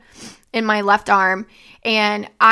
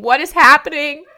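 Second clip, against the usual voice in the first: higher and louder, a panicked cry; desperate scream; 5 semitones higher, 6 dB louder than usual. Retold or imitated. Begging her unresponsive dad, in a high, panicked cry. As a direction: let the panic push the voice up. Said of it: "I just kept saying".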